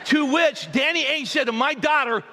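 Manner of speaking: preacher building to the punchline. Real, not acted. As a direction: build up to the big line.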